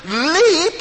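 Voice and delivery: high, ringing command to leap; drawn-out joyful exclamation; exultant call. Acted.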